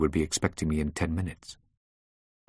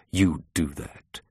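slow, deliberate, restrained anger; ill-disguised anger; 3 semitones lower, slower than usual. A narrator's reading. Boss ending the call with employee, suppressed anger. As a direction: say it slowly, holding back anger. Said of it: "with ill-disguised anger".

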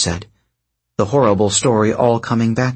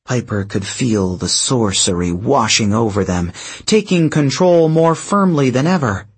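ordinary delivery

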